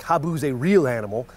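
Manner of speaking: ordinary talk, nothing marked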